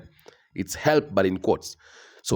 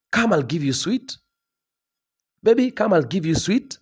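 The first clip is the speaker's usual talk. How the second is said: sing-song higher coaxing parent, lightly voiced; coaxing a child; 7 semitones higher than usual. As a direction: lure the kid over with a treat.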